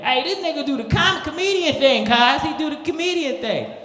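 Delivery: high, loud hype-man voice; excited Crip. Retold or imitated.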